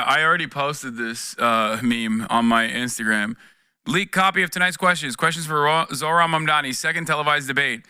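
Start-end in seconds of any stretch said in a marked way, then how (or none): none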